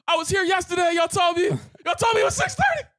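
angry yell. Retold or imitated. Customer yelling at drive-thru staff, furious high yell. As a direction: blow up at the closed kitchen. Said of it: "like, yelled".

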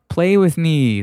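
lightly voiced ghost child, insistent; ghost boy. A narrator's reading. Ghost boy repeats his plea, high and insistent.

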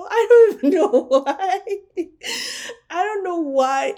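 high, loud laugh-crying; teary laughing. Real, not acted. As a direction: laugh through the tears. Laughing through happy tears.

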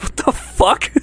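breathless, high, unvoiced what the fuck; a whispered high-pitched shock; stunned. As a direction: gasp it out in disbelief. Stunned at the kilo request.

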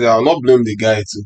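ordinary talk

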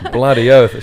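a laughing, hammed-up Australian "Bloody oath"; fake Australian accent. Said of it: "uses his fake Australian accent".

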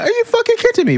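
very high-pitched mock outrage; vexed girlfriend. Retelling his partner's phone outburst, vexed. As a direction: imitate her squealed, incredulous challenge. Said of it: "she was like; She was vexed, bruv".

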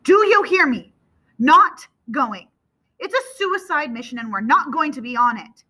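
heated, forceful refusal; very loud father. A narrator's reading. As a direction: lay down the law at high volume. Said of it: "his voice is very loud".

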